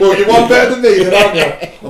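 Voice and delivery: teasing a friend through laughter; laughing. Real, not acted. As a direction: laugh out the jab.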